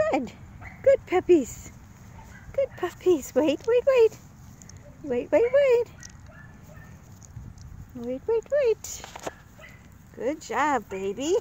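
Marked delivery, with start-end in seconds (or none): 0.8-9.0 puppy talk. Real, not acted. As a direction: coo at the puppies up high. Praising and holding puppies.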